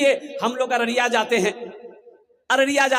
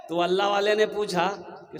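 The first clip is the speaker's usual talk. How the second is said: usual speaking voice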